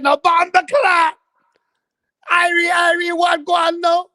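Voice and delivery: high, loud hammed-up Rasta yell; mock Rasta cry. Bitten Rastafari crying out at the dog, high mock yell. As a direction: squeal it out like the bitten Rasta man. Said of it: "He said".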